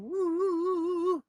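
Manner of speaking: a high wobbling woo; high quavering demo sound; wavering vibrato demo. Shown as a demonstration. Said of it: "like the wavering".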